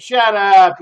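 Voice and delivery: loud, barked command; angry guard. Acted. Guard yells at the caged prisoners to shut up. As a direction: shut them up hard.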